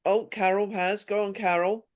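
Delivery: ordinary delivery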